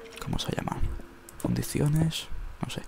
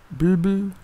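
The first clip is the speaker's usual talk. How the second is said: higher, louder bubbling; bubbling noise; 9 semitones higher, 6 dB louder than usual. Pretend. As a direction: gurgle it out playfully. Imitating bubbling sound.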